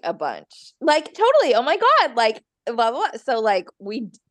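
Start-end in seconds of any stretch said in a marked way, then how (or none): 0.8-3.1 mock Valley Girl. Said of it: "make fun of us for saying "like".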